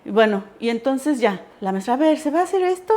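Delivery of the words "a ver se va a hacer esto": bossy teacher, pitched up. Retold or imitated. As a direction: mimic the teacher asking. Teacher voiced in retold story.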